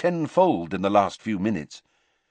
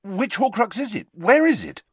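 eager demand; 12 semitones higher, 4 dB louder than usual. A narrator's reading. Harry presses Dumbledore eagerly.

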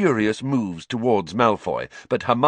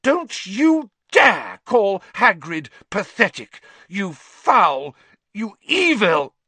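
higher, louder, slow and seething; furious; 13 semitones higher, 4 dB louder, slower than usual. A narrator's reading. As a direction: spit each insult. Hermione furious after slapping Malfoy.

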